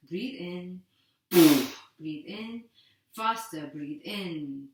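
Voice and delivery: brisk, steady breathing-class count; guided breathing cues. Acted. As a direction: keep the group breathing on each cue. Leads a timed breathing drill.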